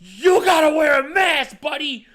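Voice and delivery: loud, high, drawn-out mock yell; retold angry shout. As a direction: imitate someone yelling at a stranger. Acted stranger scolding a customer.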